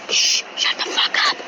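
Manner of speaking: urgent hissed whisper; angry whisper. Acted. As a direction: hush them while hiding. Hiding from the monster.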